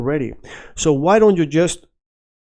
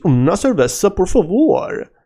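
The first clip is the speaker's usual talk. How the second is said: Swedish accent; 6 semitones higher than usual. Shown as a demonstration. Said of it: "If you're Swedish".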